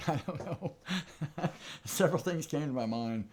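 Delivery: high-pitched, real laughter through the words; laughing. Real, not acted. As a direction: talk while cracking up, voice lifted. Amused, laughing while answering viewer.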